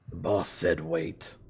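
low, slow, menacing henchman; low slow thug voice. Acted. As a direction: growl the order like a thug.

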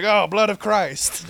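slow, solemn mock-church voice; mock reverent. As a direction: intone it like a priest. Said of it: "I was like".

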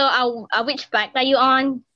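usual speaking voice

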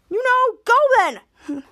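high, loud, drawn-out retort; acted angry retort. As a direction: yell it back at a sibling. Brother snaps back in quarrel.

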